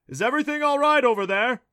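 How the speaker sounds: loud, high call across the camp; dad calling to the kids from afar; calling over. A narrator's reading. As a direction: call out from a distance. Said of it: "Clancy's dad called".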